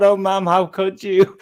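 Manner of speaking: slow, whimpering mock sob; mock crying plea. Retelling Bogart losing his booze, mock sobbing plea. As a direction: ham up a heartbroken drunk's whine. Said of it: "he was going".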